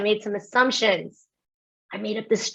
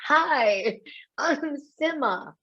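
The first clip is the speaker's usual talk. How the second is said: slow, hanging intro; 5 semitones higher, 4 dB quieter, much slower than usual. Shown as a demonstration. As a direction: let the first word hang, then a slow, soft "I'm Sima". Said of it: "I gotta let that first word hang there".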